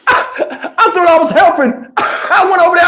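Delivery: laughing through the words; cracking up at himself; high laughing delivery. Real, not acted. Laughing at own mistake.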